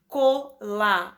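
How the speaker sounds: colaborar said slowly as co-la-bo-rar; slow syllable by syllable. Shown as a demonstration. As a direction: spell out colaborar in slow beats. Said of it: "polissílaba". (colaborar dividido em sílabas, devagar)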